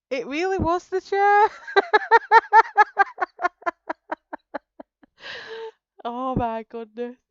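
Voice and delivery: high laughing burst. Real, not acted. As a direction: break into a laugh at the punchline. Laughing at her own story.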